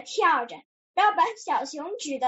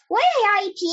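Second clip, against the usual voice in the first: higher, louder eager cry; calling out; 5 semitones higher, 5 dB louder, faster than usual. A narrator's reading. Frog and piglet both ask for lemonade, calling out. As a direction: pipe up to claim a share. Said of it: "弗洛格和小猪同时叫道".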